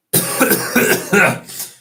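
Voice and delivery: harsh dry cough from a cold; coughing fit. Real, not acted. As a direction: cough hard, sick with a cold. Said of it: "Sorry for the coughing".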